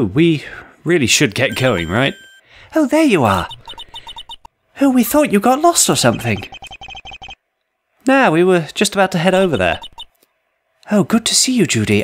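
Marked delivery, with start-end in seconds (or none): 2.7-12.0 much higher, bright parent voice; high character voice. Acted. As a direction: pitch way up for the character.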